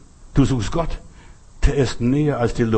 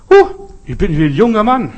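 high, loud cry of delight; joyful amazement; 8 semitones higher, 8 dB louder than usual. Acted. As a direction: burst out like a man suddenly young again. Naaman emerges healed. (freudig überrascht, hoch und laut)

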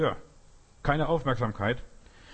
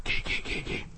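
zaghaftes Wegscheuchen; 4 dB quieter than usual. Retold or imitated. Child shoos the attacking dog.

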